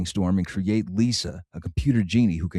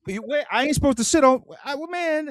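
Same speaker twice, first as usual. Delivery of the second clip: mock panicked freshman.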